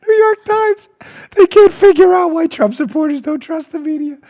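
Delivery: high-pitched, on the edge of laughter; laughing disbelief. Real, not acted. Host incredulous at a newspaper story, high laughing voice. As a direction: push the words out while cracking up. Cracking up over news story.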